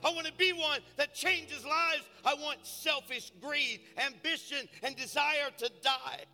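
loud, high fervent preaching; preaching at full force. Acted. Pastor rallying his church for the city, loud fervent preaching. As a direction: build the congregation up, shouting with fire.

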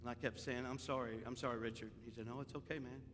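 soft, low confessional telling; subdued confiding. Real, not acted. Pastor confiding his grief to the congregation, softly. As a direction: open up quietly to the folks.

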